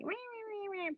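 high, soft nagging mockery; mocking blah blah. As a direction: mimic the nagging moms. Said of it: "some of the moms are gonna be like".

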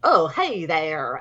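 drawn-out mock Southern greeting; bad Southern accent. House painter answering Liza, in a badly faked Southern accent. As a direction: do a slow, loud, dubious Southern accent. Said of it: "sounds like a Southern accent".

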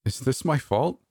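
high, tearful little question; a whimpered, high question; whimpering. Acted. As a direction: plead through tears. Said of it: "I whimpered".

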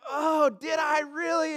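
loud, high, drawn-out "oh" of disbelief; mock regretful cringe. Preacher acting out our painful memories, loud regretful cringe. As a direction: cringe aloud at an old memory. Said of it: "memories where we're like".

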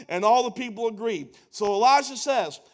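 ordinary talk

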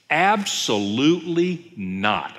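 slow, emphatic refusal; stretched-out, forceful rejection. Acted. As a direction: draw it out as a firm, weighty denial. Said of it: "I mean, this is emphatic".

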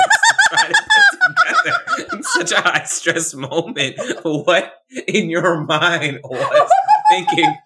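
words broken by laughter; laughing. Real, not acted. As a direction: laugh through the question. Laughing at the story.

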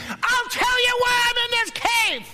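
high, loud, indignant shout; a high, loud, exasperated cry; exasperated outburst. Acted. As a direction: Elijah venting at God, fed up. Elijah's outburst at God.

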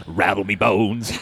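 put-on slow, spooky old skeleton pirate. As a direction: drawl it like a bony old pirate. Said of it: "Pirate, yeah".

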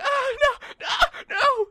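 loud high squealed protest; mock squealing in a gnome voice; tickled gnome. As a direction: shriek it as if being tickled. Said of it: "he's like".

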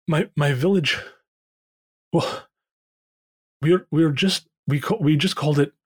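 lightly voiced dwarf, halting and upset; distressed dwarf. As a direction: falter through it as a shaken dwarf. Game master plays distressed dwarf Thorin.

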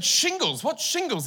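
high, alarmed double take; stunned patient hearing the diagnosis; shocked disbelief. Retold or imitated.

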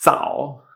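zao, unaspirated ts and Chinese third tone. Shown as a demonstration. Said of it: "como una T S, pero con una vibración".

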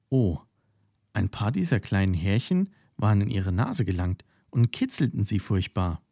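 usual speaking voice

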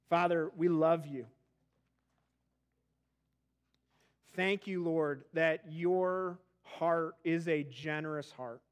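hushed, unhurried prayer voice; closing prayer. Acted. Pastor leading the church in prayer, hushed and reverent.

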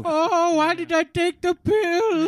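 high, slow mock lament; hammed-up despair; mock regret. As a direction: wail it like a sorry fool. Voicing a regretful figure.

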